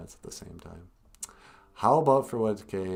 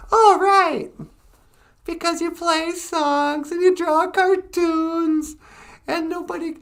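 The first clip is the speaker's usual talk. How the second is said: very high, loud squeaky puppet; 11 dB louder, slower than usual. Acted. Puppet Natasha mocking the ventriloquist, very high and loud. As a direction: tease him in a squeal.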